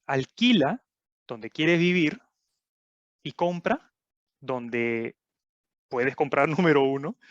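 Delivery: phrase by phrase with long pauses; measured delivery, pausing after each chunk; slow, deliberate. Real, not acted. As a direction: spell out the concept slowly, piece by piece. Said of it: "cuando lo digo así tan despacio".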